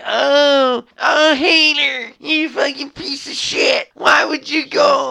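high, loud, crying mock whine; mocking a whining critic, voice cracking high; whiny scold. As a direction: whine at the hater like a wounded critic. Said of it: "You could sit there and say to me".

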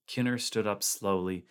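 ordinary delivery

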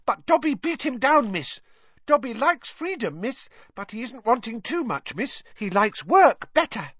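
high squeaky elf voice; squeaky, eager house-elf. Acted. Dobby's voice.

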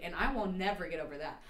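ordinary talk

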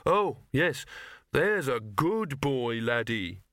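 put-on slow sing-song good-boy voice; coaxing praise. Old dog grudgingly praising the big dog, syrupy.

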